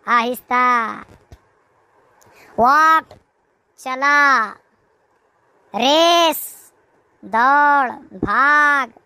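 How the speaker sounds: ordinary talk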